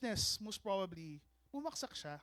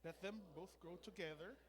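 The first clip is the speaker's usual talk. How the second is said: scripture reading; 2 semitones lower, 16 dB quieter than usual. Real, not acted. Pastor reading the parable with the congregation, hushed scripture reading. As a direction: read scripture softly, phrase by phrase.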